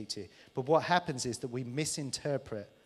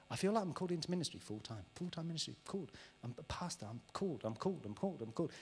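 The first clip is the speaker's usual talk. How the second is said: soft mock insistence; 8 dB quieter than usual. Mock anxious called person.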